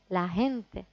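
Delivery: "gente" with a gentle h sound; "la gente" with a light breathy J; soft J. Shown as a demonstration. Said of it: "podéis hacerlo un poquito más suave".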